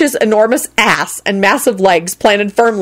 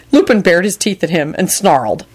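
ordinary delivery, nothing marked

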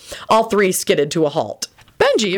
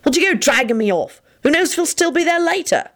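high-pitched aggrieved complaint; snarling; 11 semitones higher than usual. A narrator's reading. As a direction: climb higher as you argue back. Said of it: "Lupin snarled back".

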